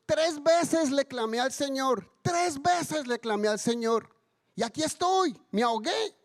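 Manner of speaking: hammed-up indignant protest; aggrieved complaint. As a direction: list your grievance to Peter, offended. Angry man complains to Peter.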